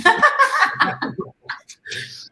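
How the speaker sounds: airy unvoiced laugh, high; breathy laugh. Real, not acted. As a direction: burst into a real laugh. Laughs at own slip.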